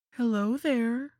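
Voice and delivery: put-on high, slow cooing to a baby. A narrator's reading. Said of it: "I cooed".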